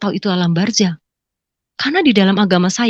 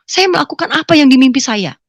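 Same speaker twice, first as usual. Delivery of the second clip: normal talking voice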